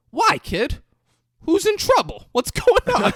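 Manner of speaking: high, loud, alarmed squawk; panicky lawyer. Retold or imitated. Clueless lawyer freaking out at his client on the phone. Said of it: "the lawyer goes".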